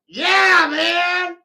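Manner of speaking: high, loud cheer; excited shout. Real, not acted. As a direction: whoop it out. Shouted cheer after song.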